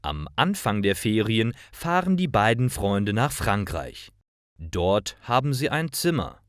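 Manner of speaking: plain reading voice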